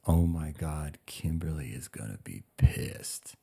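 low, slow, under-the-voice realization; hushed dread. Retold or imitated. First thought after hearing he's going home, hushed dread. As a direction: say it softly, as a sinking private thought.

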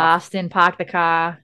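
Boston "park the car" with dropped R's; Boston accent. Retold or imitated. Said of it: "I don't say park".

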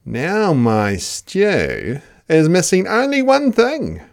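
high, loud, cheeky Dingo; a greedy dog egging the others on. Acted. Dingo cheers Platypus tipping mud in.

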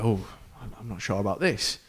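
retold doubt, softly spoken; mild hesitation. Passenger eyeing bad weather before takeoff, quietly hesitant. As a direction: voice the passenger's unease under your breath.

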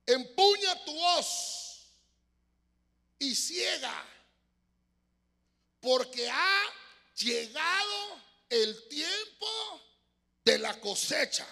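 proclama lenta y fuerte, aguda; voz potente del ángel. Acted. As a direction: clama como ángel desde el templo. Predicador actúa voz del ángel. (voz potente, lenta y solemne)

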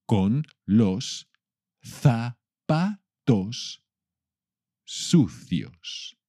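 each word slow and spaced. Shown as a demonstration. Teacher slowing down for the class. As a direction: spell it out slowly for the learner. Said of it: "Y ahora un poco más despacito".

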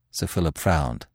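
usual speaking voice

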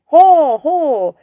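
loud, high mock call of "ho"; imitated shout. Cook imitates the shout his master should have given, loud and high. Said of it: "pero ayer no gritasteis".

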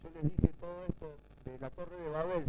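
usual speaking voice

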